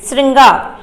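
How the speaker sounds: ordinary delivery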